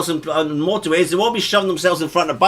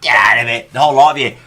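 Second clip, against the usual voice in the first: loud, lower-pitched command; gruff mock telling-off; acted officer's order; 5 semitones lower, 5 dB louder, faster than usual. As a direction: order the crowd off loudly. Acts out officer clearing a crowd.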